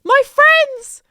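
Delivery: loud, very high panicked cry; freaking out. Retold or imitated. Head under a closing shop door, panicked cry. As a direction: call out in a mock panic. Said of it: "start to freak out".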